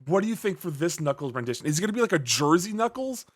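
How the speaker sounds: ordinary delivery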